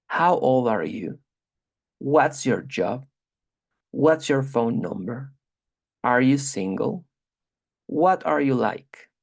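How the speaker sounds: each question read slowly and evenly; slow model reading. Shown as a demonstration. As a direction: read them out slowly for the learner. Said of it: "Y aquí están las preguntas". (lectura lenta y clara de preguntas)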